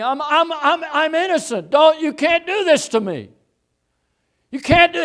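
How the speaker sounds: frantic, very high yelled protest; protesting shout. Acted. Preacher acting out the protest Jesus never made, frantic shouting.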